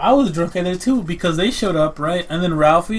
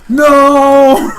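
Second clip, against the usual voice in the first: a sudden, much louder and higher cry of No!; panicked shout; 10 semitones higher, 13 dB louder than usual. Retold or imitated. Raul shouting at the dogs eating throw up, panicked yell. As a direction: yell like you are stopping a disaster. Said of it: "Raul was like".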